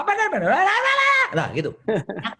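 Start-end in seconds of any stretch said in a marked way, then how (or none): 0.0-1.3 high, loud rapid-fire gibberish bickering; mock a quarrel spiralling up into noisy jabber; escalating argument. Couple answering back until voices rise, heated mock bickering. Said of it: "Lama-lama meninggi".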